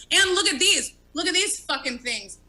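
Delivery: ordinary talk, nothing marked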